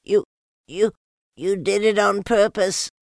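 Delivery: loud high sputtering protest; spluttering. A narrator's reading. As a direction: splutter through soapy mouth. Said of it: "spluttered Piglet".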